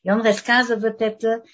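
usual speaking voice

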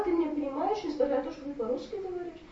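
usual speaking voice

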